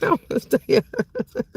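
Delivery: said through a laugh. Real, not acted. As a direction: chuckle the words out, amused.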